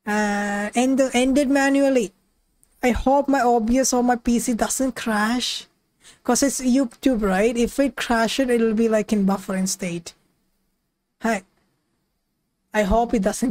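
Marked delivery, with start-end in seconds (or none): none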